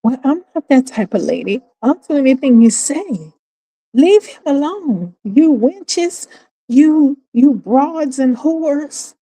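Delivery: fawning then spitting insults; mocking a loyal female supporter; mock devoted follower. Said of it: "they go in and they".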